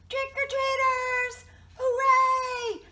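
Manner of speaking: loud, high, jubilant shout; big joyful storybook reveal; excited cheer. A narrator's reading. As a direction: cheer it like excited trick-or-treaters. Climax, cheering children revealed.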